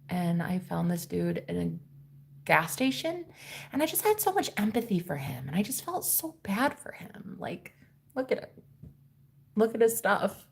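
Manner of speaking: hushed, pleading imitation; mimicked sheepish confession; meek excuse. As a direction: plead softly for sympathy, nearly whispering. Acted guest's excuse for stranger.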